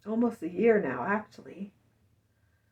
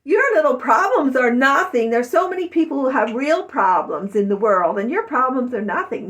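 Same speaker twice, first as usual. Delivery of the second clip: loud, lecturing sister; dismissive older sister; 3 semitones higher, 9 dB louder than usual. Retold or imitated. Older sister dismissing her problems, lecturing loudly. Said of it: "some of my older sisters even".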